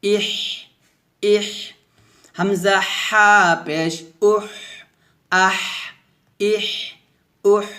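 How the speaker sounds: long mid-throat h ending আহ, ইহ, উহ; slow spelling with airy h in আহ, ইহ, উহ; thin breath. Shown as a demonstration. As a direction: squeeze thin air out after each vowel. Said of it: "চিকন একটা বাতাস বের হবে".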